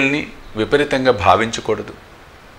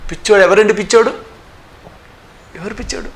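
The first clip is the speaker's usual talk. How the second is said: indignant; 9 semitones higher than usual. Real, not acted. Indignant rhetorical question.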